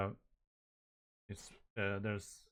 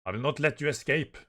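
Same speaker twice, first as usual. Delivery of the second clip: raised, loud villain threat; 5 semitones higher, 8 dB louder, faster than usual. Acted. Attacker leaps onto the ship after them, threatening. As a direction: call out the threat forcefully.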